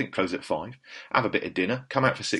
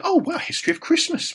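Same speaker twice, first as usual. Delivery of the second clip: much higher put-on voice for the actor; imitating Colin Firth. The actor, reading the book title aloud, high imitation.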